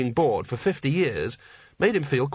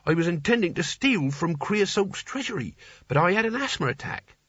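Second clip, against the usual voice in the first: higher-pitched, character voice for a hapless novice; eager would-be hero Nigel; 5 semitones higher than usual. Acted. Nigel explains to Rincewind how he landed here, in an eager novice's voice. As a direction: play an earnest amateur adventurer.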